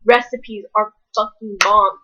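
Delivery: ordinary talk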